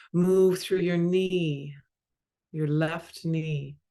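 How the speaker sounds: ordinary talk, nothing marked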